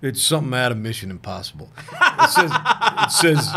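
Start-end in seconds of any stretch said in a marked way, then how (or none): 1.9-3.5 a high, laughing burst on the words. Real, not acted. Laughing while telling a friend about the text. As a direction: laugh it out, caught off guard.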